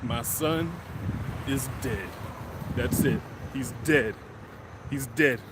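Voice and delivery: low, soft, hollow dread; a father sure his son is dead, low and quiet; despairing. Retold or imitated. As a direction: mutter it as if all hope is gone. Dad's despairing inner thoughts.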